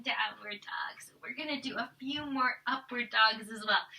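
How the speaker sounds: soft whispered cue; whispered instructions. Real, not acted. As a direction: hush it to the resting class. Teacher hushes during pose.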